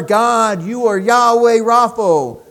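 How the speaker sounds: loud, ringing proclamation of God's name; building praise. Acted. Preacher proclaiming God's names to congregation, loud and ringing. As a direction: proclaim it with full force.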